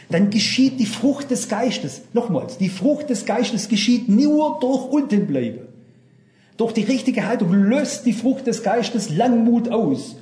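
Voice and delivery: slow insistent preaching; emphatic preaching. Acted. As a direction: hammer home the point from the pulpit.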